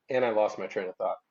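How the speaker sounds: ordinary talk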